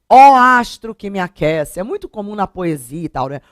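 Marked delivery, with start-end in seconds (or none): 0.1-1.8 declamed slow and loud, like hailing the sun; invocação poética. Shown as a demonstration. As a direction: call out to the sun as in a poem. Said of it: "você interpelando, falando com o sol". (invocação poética, lenta e mais alta)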